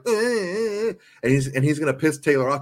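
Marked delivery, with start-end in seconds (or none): 0.1-0.9 mock whiny Travis. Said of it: "he's just gonna be like".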